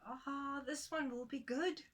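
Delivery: a hint of a pleased woodcutter; pleased woman. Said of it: "she said".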